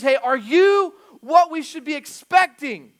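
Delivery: loud, high, drawn-out question with YOU and EXPECTING punched; emphatic questioning. Retold or imitated. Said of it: "Big question mark".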